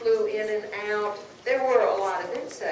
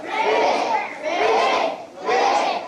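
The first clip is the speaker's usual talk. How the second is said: high loud ribbit croak; 11 semitones higher, 8 dB louder, much slower than usual. Shown as a demonstration. Storyteller cueing the audience's frog part, loud high croak. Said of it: "Ribbit, this is your part".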